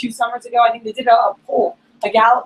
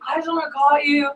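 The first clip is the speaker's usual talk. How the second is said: higher-pitched mock tipsy gushing; drunk friend; 5 semitones higher, 3 dB quieter than usual. Drunk friend phoning his buddy late, imitated sentimental gushing. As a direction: imitate a drunk pal pouring his heart out. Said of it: "your drunk friends call you and".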